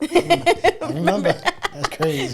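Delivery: high, slow laughing remark. Real, not acted. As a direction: laugh through the memory. Laughing at lollipop memory.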